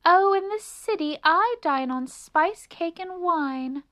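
high, loud, grand boasting; lofty city mouse. A narrator's reading. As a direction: show off grandly to a country friend. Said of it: "rather grandly".

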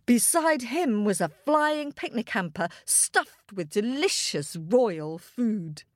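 ordinary delivery

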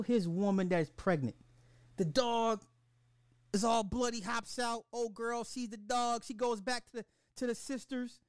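high, wailing disbelief; spazzing out. Real, not acted. Fan recapping the killing to viewers, high-pitched distraught spazzing. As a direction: recount it in a high, appalled voice. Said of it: "spazzing like this".

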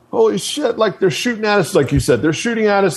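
mock scream of alarm, pitch up without much volume; retold panicked screaming.

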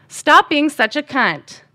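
high, loud, drawn-out yell; retold yell. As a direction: yell it slowly at your mom, reenacted. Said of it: "yell at my mom".

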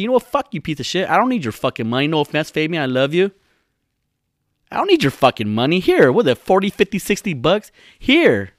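high, loud mock indignation; indignant. Podcaster imagining a real girl's reply to Fabian, indignant. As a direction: act out an insulted woman throwing money back. Acting an insulted woman paying back.

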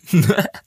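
sudden high, loud laugh; high loud burst of laughter. Real, not acted. Partner laughs after the joking threat, high and loud. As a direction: break into a bright laugh at her own joke.

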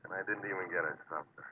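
a private gripe not meant to be heard, rushed; muttered aside. Acted. Diver grumbling to himself before the dive, muttered quietly. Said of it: "Huh? What was that?".

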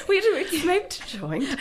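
a hammed-up posh English girl; posh accent. Shown as a demonstration. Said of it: "I'm sounding posher by the second".

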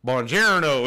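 loud mock Tennessee accent on Italian; proudly mangle the greeting in a Tennessee accent. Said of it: "His, his Tennessee accent".

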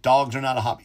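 ordinary talk

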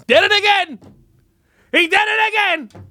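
high, loud mock indignation; mock outrage. Host ranting about [name], high mock outrage. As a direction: squeal it out in fake outrage.

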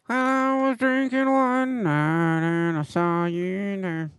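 high, slow twangy singer impression; hillbilly singer voice. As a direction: sing it like an old country crooner. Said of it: "Let me guess, like this".

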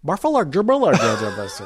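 exaggerated mock-American babble; mocking American English. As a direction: blurt American-sounding nonsense to poke fun. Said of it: "that's how they heard it".

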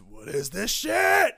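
high, loud, slow roaring shout; drunk sergeant shout. Retold or imitated. Hungover drill sergeant bursting into barracks, slow loud roar.

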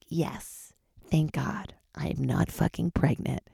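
slow, soft relieved whisper. Retold or imitated. Young woman relieved to get her period, a slow whisper. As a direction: breathe it out in secret relief.

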